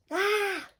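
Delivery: high, sudden happy gasp; delighted squeal. Real, not acted. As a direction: squeal at the sight.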